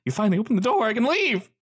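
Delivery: high, startled man's voice; a jittery prisoner caught off guard; nervous trapped man. Acted. GM voices Rick.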